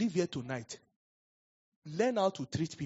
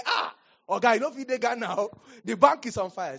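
loud, high, frantic friend calling; a colleague yelling that the bank is on fire; panicked friend; 6 semitones higher, 7 dB louder than usual. Retold or imitated. A frantic colleague on the phone, loud and high.

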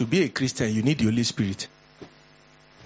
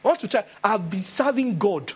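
much higher, offended shriek; indignant old sister; 8 semitones higher than usual. Retold or imitated. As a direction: refuse like a proud elder. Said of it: "They say".

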